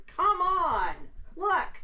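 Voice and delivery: high and loud, excited; excited calling out. Real, not acted.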